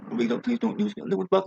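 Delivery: high, prim put-on voice; mocking prissy European. Ranter mocks a prissy European in a high voice. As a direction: parody a prim complainer in a high voice. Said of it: "Who are like".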